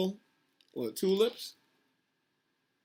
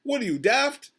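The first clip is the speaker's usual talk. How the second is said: higher, exasperated protest building to a loud ME; indignant yelling; 4 semitones higher, 6 dB louder, slower than usual. A narrator's reading. Mister Payne scolding the captain for saving animals, indignant yelling. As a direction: protest loudly, hammering the last word. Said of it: "yelled Mister Payne".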